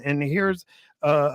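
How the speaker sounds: normal talking voice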